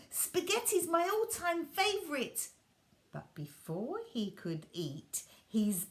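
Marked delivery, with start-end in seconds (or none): 0.1-2.5 put-on high, eager little-boy voice; a boy thrilled by his favourite food; excited child; delighted boy. Walter delighted by spaghetti.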